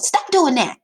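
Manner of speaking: loud, high sharp order; urgent exclamation. Shown as a demonstration. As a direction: make it sound urgent. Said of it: "Exclamation, excitement, urgency".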